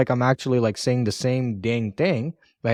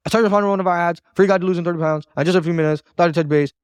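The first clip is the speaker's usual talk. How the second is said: fast, higher recitation with no pauses; memorized rattle; 4 semitones higher, faster than usual. Shown as a demonstration. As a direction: bulldoze through it like a memorized script. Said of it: "if you memorize this and you just go".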